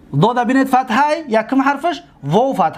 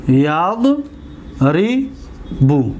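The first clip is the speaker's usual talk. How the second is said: ریبو stretched very slowly, syllable by syllable; slow, drawn-out ریبو; 5 semitones lower, much slower than usual. Shown as a demonstration. Teacher drills students on a verb form, very slow. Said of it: "دقت دادید؟".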